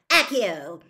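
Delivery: loud commanding spell; spell cast. A narrator's reading. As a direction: call out the spell with force. Casting a spell.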